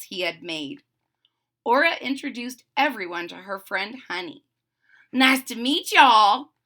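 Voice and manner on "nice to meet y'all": put-on loud, drawling southern accent. A narrator's reading. Honey meeting the puppies, in a southern accent. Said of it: "said in a southern accent".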